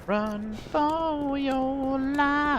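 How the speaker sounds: very slow, high, drawn-out hammy call; dramatic drawn-out cry. Pretend. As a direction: stretch it like a movie panic cry.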